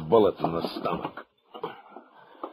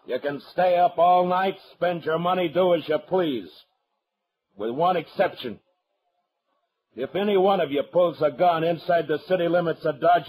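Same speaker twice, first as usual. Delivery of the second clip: raised, high voice that carries over the whole room; calling out to the crowd; 9 semitones higher than usual. Acted. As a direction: lay down the law so every cowboy hears. Marshal lays down law to cowboys.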